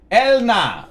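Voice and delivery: loud, high call across a hall; a councilman shouting for the elf cleric. Acted. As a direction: summon her from across the room. Agra calls across the chamber.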